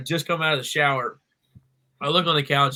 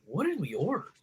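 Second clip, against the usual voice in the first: high, soft, swooping disbelief; retold bewilderment; 4 semitones higher, 8 dB quieter than usual. Man looking at two sacks of food, baffled. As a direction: puzzle it out in a small high voice.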